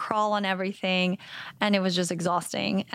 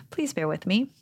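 ordinary delivery

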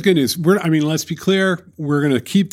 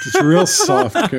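laughing; 6 semitones higher than usual. Real, not acted. Joking about leadership takeover.

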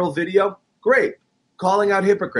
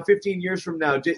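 ordinary talk, nothing marked

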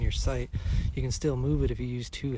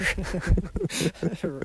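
high, loud burst of laughter; amused laugh; 7 semitones higher, 7 dB louder than usual. Real, not acted. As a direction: crack up laughing. Laughing at the broken stabilizer.

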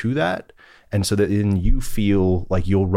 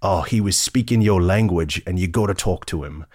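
put-on quoting a jolly British colleague in a British accent; bad English accent; 3 semitones higher than usual. Guest imitating his British colleague's phone call, light British accent. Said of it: "attempt at an English accent".

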